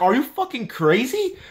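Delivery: high, stunned disbelief; incredulous. Retold or imitated. Talking to believers who never read the Bible, high stunned disbelief. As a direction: fire the question up high in shock. Reacting to believers not reading.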